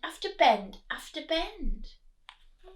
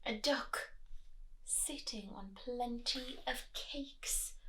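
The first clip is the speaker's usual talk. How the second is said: whisper; 13 dB quieter than usual. Real, not acted. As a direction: whisper it like a secret. Aside to child, whispering.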